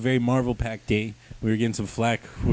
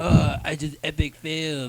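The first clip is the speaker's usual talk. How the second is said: slow, dumb-sounding hero voice; mock dopey hero; 3 semitones higher than usual. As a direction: play the hero as clueless. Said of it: "And then the guy's like".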